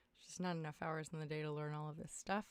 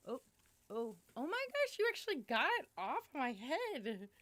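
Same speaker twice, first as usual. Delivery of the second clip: surprised squeal; 6 semitones higher, 5 dB louder than usual. Real, not acted. Cat grabs item off head.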